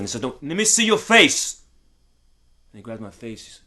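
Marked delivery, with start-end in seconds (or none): 0.2-1.6 much higher, slow, pressing command; insistent father. Retold or imitated. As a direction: press harder, pitch climbing.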